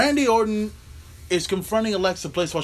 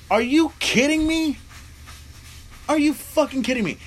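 pitched-up incredulous rant; outraged disbelief; 8 semitones higher than usual. Real, not acted. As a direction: yell it up high like you can't believe what you just watched. Outraged at the match.

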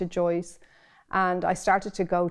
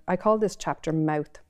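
usual speaking voice

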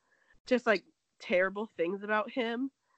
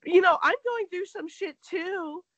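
mock whine; 9 semitones higher, 6 dB louder than usual. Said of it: "And then she was like".

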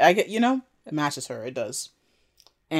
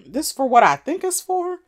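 incredulous question; 13 semitones higher, 6 dB louder than usual. Retold or imitated. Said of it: "looking at Whisper like".

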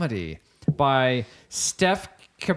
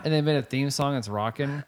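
normal talking voice

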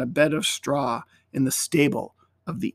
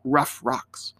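ordinary delivery, nothing marked

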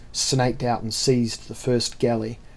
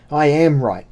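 louder, higher, defiant retort; heated insistence; 4 semitones higher, 7 dB louder than usual. Acted. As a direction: insist forcefully against your enemy. Captain insists in heated confrontation.

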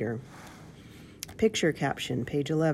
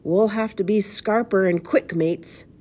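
louder, higher urgent pirate; pirate's warning; 5 semitones higher, 4 dB louder than usual. A narrator's reading. Pirate warns his mates of danger, urgent call. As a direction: urge your mates to run. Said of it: "one of them pronounced".

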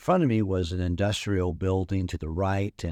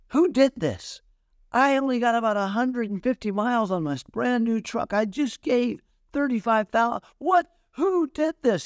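irate outburst. Retold or imitated. Owner ranting about his brand new truck, high furious yell. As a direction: rant in outrage at the damage. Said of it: "he was irate".

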